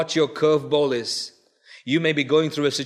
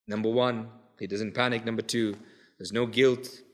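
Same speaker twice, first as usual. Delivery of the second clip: soft, lower recap of the points; quiet summing up; 7 semitones lower, 8 dB quieter than usual. Acted. Preacher recapping Elisha's lessons for the church, quietly. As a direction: wind it down gently.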